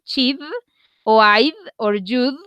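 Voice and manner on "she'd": she'd, final d sounded clearly; she'd, la d audible; pronounce the d. Shown as a demonstration. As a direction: land the d at the end of she'd. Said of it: "La idea es que se pronuncie la d".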